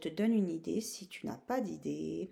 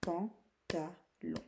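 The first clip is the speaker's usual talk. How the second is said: "pantalon" slowly, one beat per syllable; clap-along "pan, ta, lon" with gaps; much slower than usual. Shown as a demonstration. Teacher helping pupils clap along, syllable by syllable. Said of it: "taper dans les mains pour vous aider".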